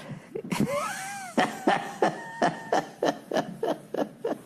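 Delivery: a long, high burst of laughter; big laugh. Real, not acted. Comic laughing at the car dealer story. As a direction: crack up at the salesman story.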